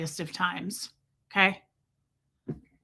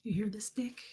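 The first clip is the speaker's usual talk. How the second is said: unvoiced whispered aside; 13 dB quieter than usual. Real, not acted. She whispers to viewers about her whining cat, hushed. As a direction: hush it so the cat won't hear.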